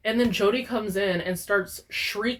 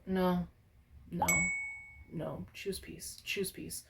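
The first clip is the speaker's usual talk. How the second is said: much softer, whispered choose peace; whispered calm; 12 dB quieter, slower than usual. Real, not acted. Calming herself down.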